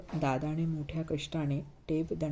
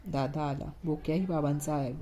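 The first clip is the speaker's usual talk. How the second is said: ordinary delivery, nothing marked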